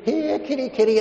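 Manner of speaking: high singsong call; calling the cat. Retold or imitated. Owner calling the family cat in a high singsong coax. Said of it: "You'd say".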